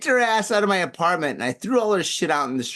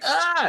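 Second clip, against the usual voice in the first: high-pitched yelp of sudden shock; jolted yelp. Retold or imitated. Man retelling an icy feeling turning to pain, yelping. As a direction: cry out as if zapped. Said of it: "this electric jolt that went through".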